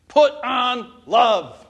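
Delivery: slow, loud, every word weighted; preacher hammering the key phrase; emphatic refrain. Real, not acted. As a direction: drive the refrain home slowly. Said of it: "sermon refrain, slow and loud".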